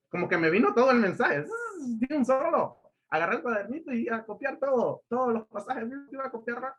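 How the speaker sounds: high, animated burst of inspiration; retold excitement. Preacher recalling the message arriving at once, retold excitement.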